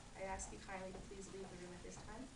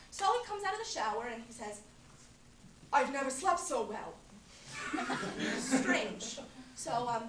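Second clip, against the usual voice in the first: a woman recounting a strange night with Barry, wry and lively; Linda monologue; 5 semitones higher, 11 dB louder than usual. Acted. Actress tells audience about her night with Barry, wry and amused. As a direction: tell it like confiding a juicy story to a friend. Acted Talk Radio monologue.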